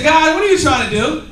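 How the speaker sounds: loud, high laughing shout; laughing outburst. Retold or imitated. Retelling meeting the dealership owner, loud laughing exclamation. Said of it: "I said".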